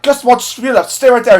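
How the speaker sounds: captain's order. Retold or imitated. Reviewer acting out the captain shouting a loud high order. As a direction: bark it as a captain ordering his man. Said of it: "the captain's like".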